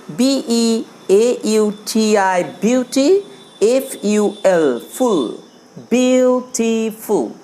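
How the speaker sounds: বিউটিফুল built up slowly from letters; broken-up reading. Shown as a demonstration. Teacher builds a word for the class slowly. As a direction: spell and join বিউটিফুল step by step. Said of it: "এইভাবে আমরা ভেঙে ভেঙে পড়ব".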